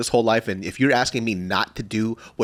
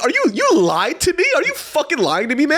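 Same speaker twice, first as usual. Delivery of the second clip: high, louder, outraged retelling; mock chewing-out; 4 dB louder than usual. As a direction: confront a liar, hammed-up and high. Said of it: "he just got chewed out".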